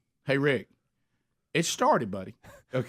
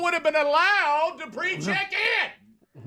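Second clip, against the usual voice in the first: mock outrage; 12 semitones higher than usual. Real, not acted. Telling co-hosts about nearly missing the flight, building mock outrage. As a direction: build from incredulous to high, stretched-out exclamation.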